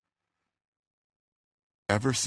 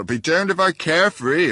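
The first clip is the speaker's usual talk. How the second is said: defiant retort; 10 semitones higher, 7 dB louder than usual. A narrator's reading. Old man repeats his retort louder.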